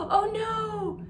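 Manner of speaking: high, hammed-up cry of fright; mock alarm. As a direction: squeal it like a scared little animal. Bear wakes, friends frightened.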